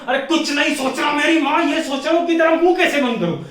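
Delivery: loud, high-pitched comic outburst; hammed-up fed-up shouting; acted exasperated yell. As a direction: explode at the endless pestering. Acted outburst at repeated pestering.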